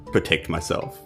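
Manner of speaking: ordinary talk, nothing marked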